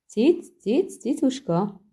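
high little call to a cat; coaxing cat call. Acted. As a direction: call the kitty over, high and light. Calling a cat.

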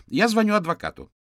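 very high, loud threat; shrieked warning from an indignant mother; outraged mother. A narrator's reading. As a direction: explode at the detectives, high and loud. Mother threatens detectives with lawyer.

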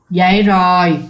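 put-on ghostly old man answering from outside, husky and low; old man's husky voice. Unseen old man answers the mother's call, low and husky. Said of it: "một cái giọng đàn ông lớn tuổi mà ồm".

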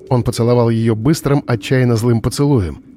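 ordinary delivery, nothing marked

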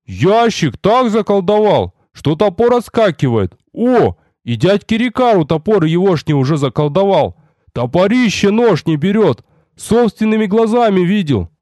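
high-pitched gossiping sentry; lightly voiced excited lookout; eager announcement. A narrator's reading. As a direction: spread the news for all to hear. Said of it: "охотно поведал первый часовой во всеуслышание".

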